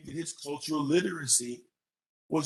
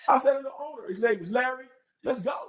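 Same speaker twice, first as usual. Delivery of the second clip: eager rallying; 11 semitones higher than usual. Retold or imitated. Retells rallying friend to the restaurant.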